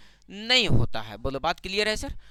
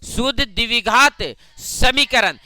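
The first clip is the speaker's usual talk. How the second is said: शुद्ध द्विघात समीकरण loud and drawn out; emphatic drill; 3 semitones higher, 6 dB louder, slower than usual. Shown as a demonstration. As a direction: hammer each word of शुद्ध द्विघात समीकरण, slowly. Emphatic answer drilled into class. (शुद्ध द्विघात समीकरण ज़ोर देकर, खींचकर)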